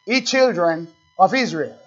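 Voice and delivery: ordinary talk, nothing marked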